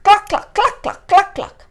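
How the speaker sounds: high, quick loud clucks; clucking hen. A narrator's reading. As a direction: squawk it out like an alarmed hen. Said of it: "the hen started clucking".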